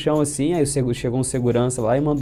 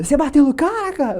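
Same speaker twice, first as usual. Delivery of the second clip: Júlio shocked; 12 semitones higher than usual. Retold or imitated. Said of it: "contei pro Júlio".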